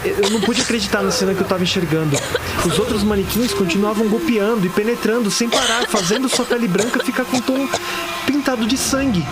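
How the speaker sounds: high-pitched, stumbling, appalled narration; horrified agitation. Acted. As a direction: tell it in rising panic, tripping over sentence starts. Narrator relives a horrifying massacre.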